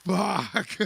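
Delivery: ordinary talk, nothing marked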